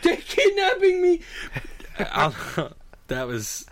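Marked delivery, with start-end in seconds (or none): 0.0-1.2 high, loud mock cry of "Hope!" for "Help!"; imitating a screaming patient. Patient crying for help from the window, hammed-up scream. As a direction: scream "Hope!" with the wrong vowel, pitched way up. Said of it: "No, he was saying hope".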